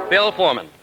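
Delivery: ordinary talk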